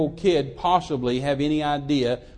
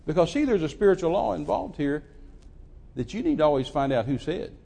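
ordinary talk, nothing marked

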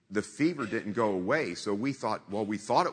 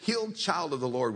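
bubbly; 3 semitones higher than usual. Retold or imitated. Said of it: "she's so bubbly".

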